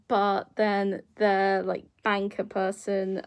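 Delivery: ordinary talk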